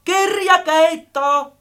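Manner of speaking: high, slow, ringing call; insistent calling. A narrator's reading. Friend calls the missing book, insistently. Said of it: "toisti vielä kovemmalla äänellä".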